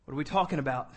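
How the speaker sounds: ordinary talk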